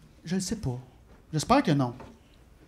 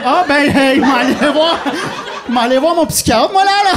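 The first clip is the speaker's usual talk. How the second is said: frantic outburst; 8 semitones higher, 8 dB louder than usual. Acted. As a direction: cry it out high and loud, stretching words. Acted outburst to inner voice.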